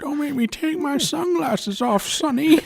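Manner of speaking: put-on high, slow old man drawl; Jimmy Stewart. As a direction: play a doddering grandpa. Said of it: "why do I sound like Jimmy Stewart?".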